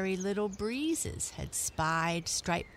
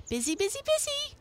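high chipmunk voice rising even higher; chipmunk shouting; much faster than usual. A narrator's reading. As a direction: toss it back over your shoulder, high and quick. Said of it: "he shouted over his shoulder".

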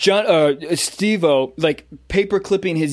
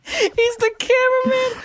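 very high squeaked punchline; squeaky reveal. Pretend. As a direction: squeak it out mockingly. Mock dramatic reveal.

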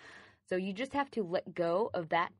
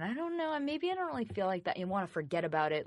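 mock whining; 2 semitones higher, faster than usual. Your emotions arguing against change, whiny. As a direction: imitate someone wheedling out of it, quick and rising. Voices reluctant inner self.

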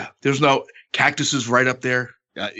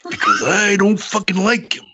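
raspy higher growl, slowed down; Savage impression; 9 semitones higher, 4 dB louder than usual. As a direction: growl it out like a wrestling promo. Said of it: "and he goes".

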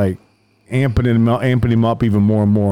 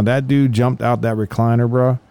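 normal talking voice

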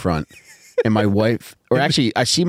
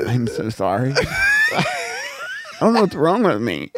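lightly raised, hammed-up contrite wife; mock apologetic wife; 5 semitones higher than usual. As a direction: overplay a wife begging forgiveness. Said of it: "Your wife almost sounded like Peter Griffin".

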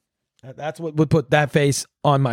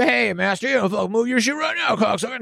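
higher-pitched mock order; mock bossy underling; 7 semitones higher than usual. Underling telling the master what to do, mock bossy. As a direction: order the master about in a put-on voice.

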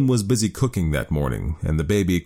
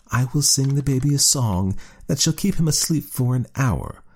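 snooty cat; 8 semitones higher than usual. A narrator's reading. Cat voiced in story.

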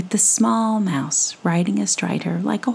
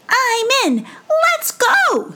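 very high, louder excited squeak; eager mouse; 4 dB louder, slower than usual. Acted. Mouse volunteering for the adventure, loud high squeak. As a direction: burst in with tiny bravado. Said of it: "eager mouse".

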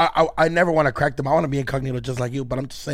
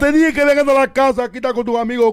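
loud, high-pitched Caribbean Spanish scolding; Caribbean Spanish accent; 10 semitones higher, 8 dB louder than usual. Acted. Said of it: "I want the accent".

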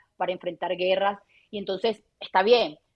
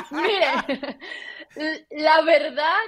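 laughing; 7 semitones higher, 5 dB louder, much slower than usual. Real, not acted. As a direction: still laughing through the words. Laughing while starting the answer.

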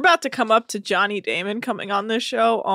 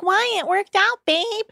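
much higher put-on partner voice; mock reassuring girlfriend; 11 semitones higher than usual. Malia answers disappointed Tom about dinner, mock high-pitched reassurance.

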